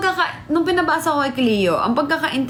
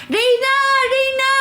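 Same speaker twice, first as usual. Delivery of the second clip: much higher and louder sing-song call; calling out name; 4 dB louder, slower than usual. Retold or imitated. Friend calling to her from the van, sing-song shout. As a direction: call across the lot to get her attention. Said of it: "may sumisigaw".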